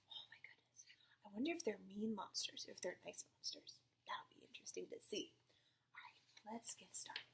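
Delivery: soft whispered wonder at the pictures; storytime whisper. Acted. Teacher showing a child the monsters, whispering.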